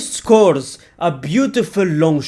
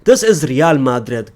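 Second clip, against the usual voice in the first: ordinary talk, nothing marked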